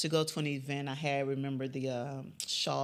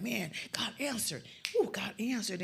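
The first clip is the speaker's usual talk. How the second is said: higher, marveling retold praise; imitated grateful amazement; 6 semitones higher than usual. People reporting back to the intercessor, imitated with excitement. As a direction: voice someone amazed at an answered prayer.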